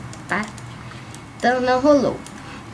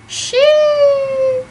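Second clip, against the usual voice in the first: uh-oh squeal; 8 dB louder than usual. Real, not acted. Reacts to the word abacaxi.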